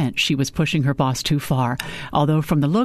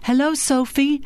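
high greeting with a round, emphasised O in Sophie; 11 semitones higher than usual. A narrator's reading. Client greets Sophie like a father, O in "Sophie" rounded and stressed. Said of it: "a roundness that put the emphasis on the O".

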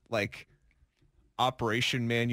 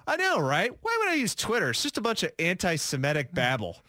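mock retort; 3 semitones higher than usual. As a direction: fire it back in the same put-on scornful tone, pitched up. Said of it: "I fire back and I go".

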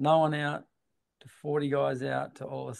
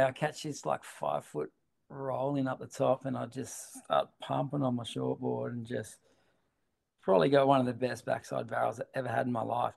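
ordinary delivery

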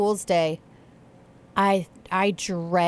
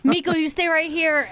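mock alarm; 8 semitones higher than usual. Voicing alarmed husband directing the children.

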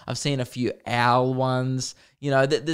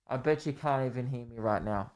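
muffled and much quieter, away from the mic; back from mic; 9 dB quieter than usual. Shown as a demonstration. Showing listeners he can't be heard, muffled and distant. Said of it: "if I put my head back from the mic".

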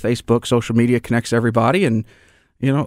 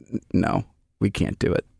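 quiet, lower, hesitant no; retold reluctant refusal; 4 semitones lower, 6 dB quieter than usual. Boss turning down the parents' request, quiet reluctant refusal. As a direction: lightly imitate a boss softly declining.

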